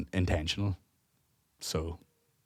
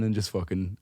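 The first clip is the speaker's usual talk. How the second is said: ordinary delivery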